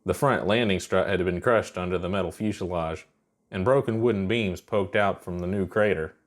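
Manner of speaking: usual speaking voice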